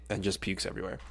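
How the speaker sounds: usual speaking voice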